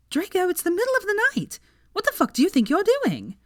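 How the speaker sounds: put-on a young woman shouting after a friend bolting out; high, loud, panicked scolding; alarmed yell. A narrator's reading. Pansy yelling after him.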